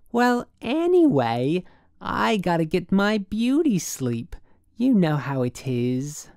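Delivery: high, slow woman's lilt; affected city woman, coy. A narrator's reading. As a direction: beg off coyly on the phone. Woman on phone, coy excuse.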